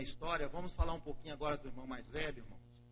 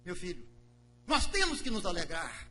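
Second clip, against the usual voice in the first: louder and higher, proclaiming; joyful father; 6 semitones higher, 14 dB louder than usual. Acted. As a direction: declare the son found with joy. Vader antwoordt vol vreugde.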